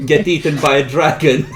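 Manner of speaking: loud. Real, not acted. Joking threat to players.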